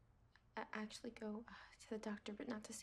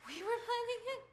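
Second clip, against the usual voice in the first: higher and louder, scornful and accusing; bitter angry retort; 5 semitones higher, 5 dB louder than usual. Acted. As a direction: throw his words back at him. Wife fights back at husband.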